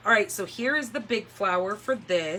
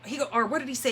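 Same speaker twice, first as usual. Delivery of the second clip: ordinary delivery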